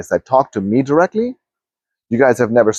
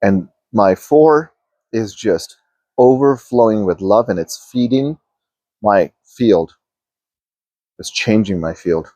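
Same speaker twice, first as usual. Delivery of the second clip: guided meditation. Acted. Coach guiding viewers inward, in a calm meditation voice. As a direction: guide listeners gently through each energy center.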